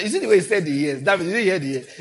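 usual speaking voice

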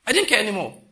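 loud, high burst of resolve; emphatic declaration. Real, not acted. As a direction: throw it out loud to the congregation. Preacher declares his resolve.

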